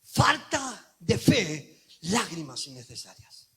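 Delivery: very slow, then dropping low; slow refrain. Acted. Preacher sums up the refrain slowly. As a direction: let it land slowly.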